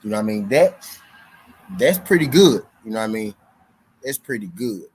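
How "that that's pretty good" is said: much higher and louder, impressed; sudden burst of approval; excited praise. Real, not acted. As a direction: hype up the stat like it's great.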